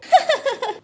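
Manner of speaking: high cackling crow laugh; cunning crow laughs at her husband's question, high cackle; sly laugh. Acted. Crow wife laughs slyly.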